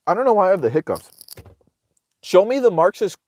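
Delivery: ordinary talk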